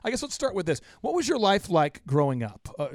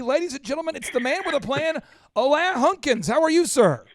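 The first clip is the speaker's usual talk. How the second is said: high-energy podcast intro; show host announcer; 7 semitones higher than usual. Acted. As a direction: hype up the guest like an emcee. Hosts the show's opening introduction.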